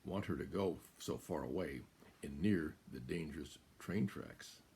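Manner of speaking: plain reading voice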